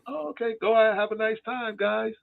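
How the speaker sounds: high, sing-song fake-friendly voice; hammed-up cheerful father waving them off; all-smiles dad. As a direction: beam at them like nothing happened.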